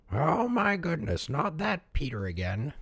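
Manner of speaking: put-on an old villager sighing at the boy again; weary old man. A narrator's reading. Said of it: "he said, shaking his head".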